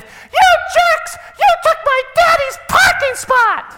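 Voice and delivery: put-on very high, loud child's shout; shrill four-year-old yelling out the car window; little girl yelling. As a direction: daughter copying her dad's angry yell. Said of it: "puts her head out the window and said".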